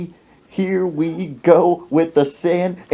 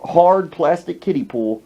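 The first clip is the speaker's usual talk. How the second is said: usual speaking voice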